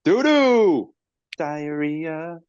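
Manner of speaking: very high, drawn-out silly call. Pretend. Clowning over a bad team, silly high-pitched hoot. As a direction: hoot it in a goofy falsetto, holding the vowels. Clowning on friend's word doo.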